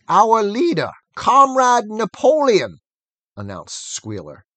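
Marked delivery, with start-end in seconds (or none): 0.1-2.8 slow, louder, firm announcement. A narrator's reading. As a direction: lay down each word slowly. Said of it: "speaking very slowly and firmly".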